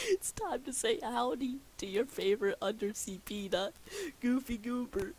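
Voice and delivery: high voice wobbling with real giggles; speaking through laughter. Real, not acted. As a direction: push the greeting through a laughing fit. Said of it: "why I'm laughing".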